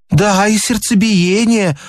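high-pitched, eager lady's yes; fibbing lady. A narrator's reading. Lady lies to the doctor about palpitations, eagerly complaining. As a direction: play the lady piling on an invented symptom. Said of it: "соврала дамочка".

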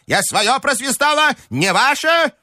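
outraged grandmother. A narrator's reading. Grandmother ordering the relatives thrown out, high and outraged. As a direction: drive them all out in a high, indignant voice. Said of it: "завопила бабушка генералу".

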